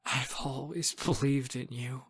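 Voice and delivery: soft, hushed prayer; tearful whisper. A narrator's reading. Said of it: "I whisper as tears pour down".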